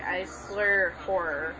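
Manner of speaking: usual speaking voice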